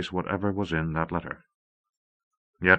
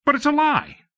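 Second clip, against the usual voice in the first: much higher, desperate protest; insisting. A narrator's reading. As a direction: refuse to believe it. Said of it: "John insisted".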